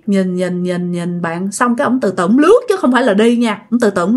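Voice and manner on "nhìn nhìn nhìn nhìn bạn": low, hushed monotone; eerie, quiet droning repetition; eerie drawn-out stare. A narrator's reading. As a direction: drop low and repeat it softly on one note. Acting out the ghost's fixed stare.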